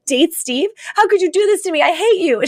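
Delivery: high-pitched indignant outburst, played up; mock teenage outrage. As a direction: act out a wounded teenager blowing up. Teen's outraged reaction, retold.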